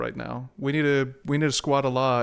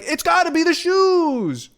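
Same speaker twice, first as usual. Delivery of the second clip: commercial slogan impression; 5 dB louder, slower than usual. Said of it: "They're trying to, like".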